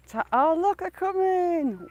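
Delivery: high, slow sing-song look, they're coming; drawn-out and excited as the ducks come; excited calling. Real, not acted. To the ducks paddling over, a high slow excited call.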